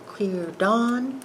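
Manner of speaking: usual speaking voice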